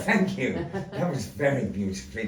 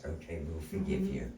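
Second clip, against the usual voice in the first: usual speaking voice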